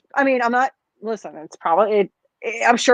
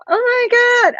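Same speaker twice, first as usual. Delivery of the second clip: much higher mock astonishment; fake surprise; 13 semitones higher than usual. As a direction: overplay a staged shocked reaction. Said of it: "to be like".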